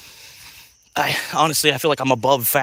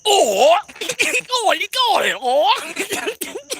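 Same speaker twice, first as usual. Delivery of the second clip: put-on high, loud caveman cry with an English accent; hammed-up English-accented caveman, cracking up; mock British caveman; 3 dB louder, much slower than usual. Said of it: "they already had an English accent".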